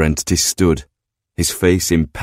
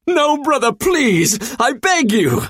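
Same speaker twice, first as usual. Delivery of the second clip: high, frantic demon plea; high frantic demon begging; desperate begging demon. Acted. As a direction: beg for your life in a high voice. Brother begs before the hammer.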